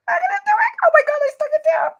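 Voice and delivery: nervous excitement. Real, not acted. Nervously sticking down the sentiment.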